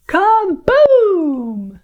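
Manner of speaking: high, loud drawn-out cannon boom. Shown as a demonstration. Storyteller firing Sleeping Beauty from a cannon, booming. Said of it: "Can you do that sound?".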